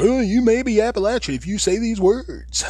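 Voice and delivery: high mocking voice of a know-it-all commenter. Mocking online list writers, prissy high sneering voice. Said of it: "talking about".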